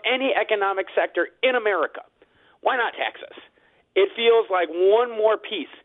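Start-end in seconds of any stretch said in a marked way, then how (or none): 2.6-3.4 higher, half-whispered sarcastic question. Real, not acted. Restaurant owner asking about his own taxes, sarcastic. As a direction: let the sarcasm drop to a near whisper. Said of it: "I say this with all sarcasm".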